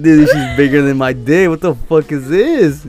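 loud mock-stunned exclamation; astonished. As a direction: act out loud disbelief, dragging the last question. Retelling his shock at the size.

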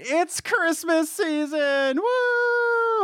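high, slow, cheery announcement; excited. Real, not acted. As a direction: squeal it out with holiday glee.

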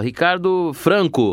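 high, slow, drawn-out name announcement; announcer calling a name. Retold or imitated. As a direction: announce the graduate's name slowly from the stage. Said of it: "eu vou lá e falo". (mestre de cerimônia anunciando o nome devagar)

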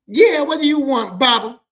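loud, high, drawn-out challenge; acted sassy yell; sassy boyfriend. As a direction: answer the door with loud attitude. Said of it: "That's how you have to do it".

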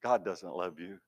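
soft, low taunting hush; hushed Satan whisper. Retold or imitated. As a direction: murmur it like a sly tempter. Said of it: "Satan will whisper".

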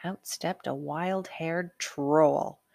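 ordinary delivery, nothing marked

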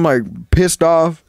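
normal talking voice

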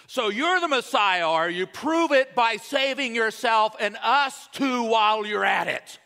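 loud, high-pitched sneering criminal shout; mean criminal. Acted. Criminal hanging beside Jesus scoffs at him, mean shouted taunt. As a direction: hurl it as a taunting insult. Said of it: "That's my mean criminal voice".

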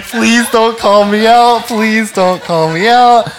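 high, loud, slow laughing mimic; laughing plea. Pastor mimicking Ashley's laughing plea not to be called out. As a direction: beg through giggles, teasing her. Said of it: "She's like".

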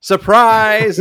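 high, loud mock reveal; spring the surprise on them; cheery game-show surprise; surprise shout.

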